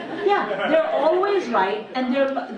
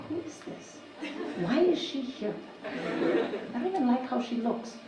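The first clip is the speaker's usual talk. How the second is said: hushed, breathy whisper of disdain; 7 dB quieter than usual. Retold or imitated. Gossiping to a friend at a coffee morning, whispered. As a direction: whisper it so the woman can't hear. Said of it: "Why are you whispering?".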